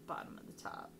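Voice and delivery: breathy whisper under the breath; whispered repeat. Real, not acted. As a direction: whisper it to yourself as you place the card. Repeats the phrase under her breath.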